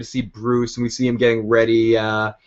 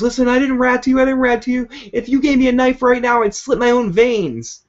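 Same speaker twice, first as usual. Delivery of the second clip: much higher, desperate begging; high panicked pleading; 12 semitones higher, 4 dB louder than usual. Retold or imitated. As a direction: plead for your life, voice pitched way up. Penguin begging Fish for life.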